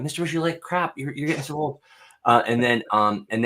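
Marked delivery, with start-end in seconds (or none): 0.0-1.8 put-on kid's voice. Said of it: "Or, you know".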